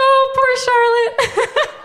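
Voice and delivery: high, slow, laughing sympathy; mock pity through laughter. I'm like.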